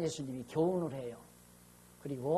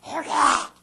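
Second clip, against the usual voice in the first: louder and higher, a throat-tight commanding shout; forceful shout; 10 semitones higher, 12 dB louder, much slower than usual. Retold or imitated. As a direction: bark it like a revival preacher showing power. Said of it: "힘을 막 목 꽉 줘가지고". (목에 힘 꽉 준 호령)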